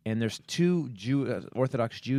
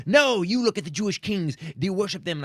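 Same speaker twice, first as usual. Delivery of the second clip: high, loud defiant rant, retold; imitated screaming; 8 semitones higher, 4 dB louder than usual. Traveler imitating the man pointing his umbrella, screaming more. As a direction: yell back like the ranter. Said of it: "starts screaming more".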